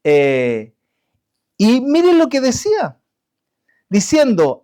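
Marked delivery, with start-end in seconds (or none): none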